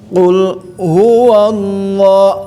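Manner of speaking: slow "Qul huwallah" with lah held two beats; long lo recitation. Shown as a demonstration. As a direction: stretch the lo in "huwallah". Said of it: "lo-nya harus panjang ya".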